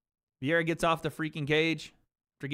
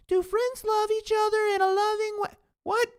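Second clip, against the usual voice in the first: much higher, mocking the viewer's question; mocking squeaky voice; 5 dB louder than usual. Streamer mocking a chat question, in a squeaky voice. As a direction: squeak it back like a silly kid.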